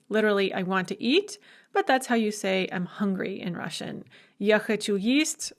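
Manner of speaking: ordinary talk, nothing marked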